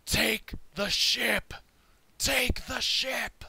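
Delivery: high, loud, drawn-out battle cry; pirate captain bellowing a command; screaming order. Acted. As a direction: shout it across the deck to your crew. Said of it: "he's screaming to his crew".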